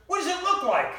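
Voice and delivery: high, loud, in a put-on wife voice; mock wife yell. Wife yelling after husband about the sick dog, high loud yell. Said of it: "She yells after me".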